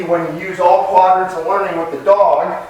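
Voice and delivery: usual speaking voice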